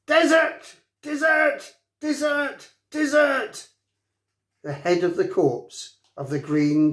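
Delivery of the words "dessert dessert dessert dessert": a little dragon screeching in excitement; dragon shriek. A narrator's reading. Fireworm the dragon shrieks at the boys, piercing shriek. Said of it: "she shrieked".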